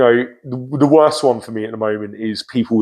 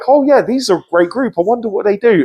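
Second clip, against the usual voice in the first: high mock wonder; 8 semitones higher than usual. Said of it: "I was like".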